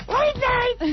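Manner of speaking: high mock daughter voice; girl's voice. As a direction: answer like a young girl. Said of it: "that was my daughter on the phone".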